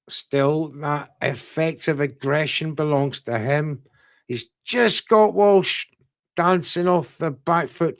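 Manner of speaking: ordinary talk, nothing marked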